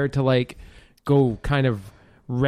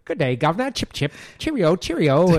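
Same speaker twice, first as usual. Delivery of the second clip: mock posh British accent, a bit higher in places; bad British accent; 4 semitones higher than usual. Mocking Kevin Bacon's British arms dealer in a bad British accent. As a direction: ham up a Monty Python British accent. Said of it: "he can't pull off this British accent".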